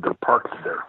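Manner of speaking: whispered secret warning; hushed, voiceless aside about the watchers. Retold or imitated. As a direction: breathe it like a secret.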